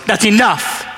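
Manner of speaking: firm, abrupt command; stern rebuke. Retold or imitated. Jesus to the man piping up, stern rebuke. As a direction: shut the heckler down. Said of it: "looks at him and goes".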